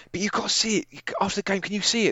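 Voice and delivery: put-on a kid begging dad to watch the replay; pleading child. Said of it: "and he was like".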